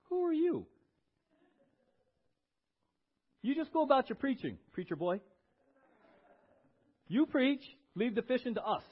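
cranky, dismissive challenge with pauses; cranky Peter. Acted. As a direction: brush off the preacher like a grumpy fisherman. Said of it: "I'm a little bit cranky".

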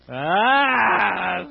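a big loud ah of delight; loud exclaiming ah. Real, not acted. Preacher revealing a fun fact, loud ah. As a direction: let out a loud triumphant ah.

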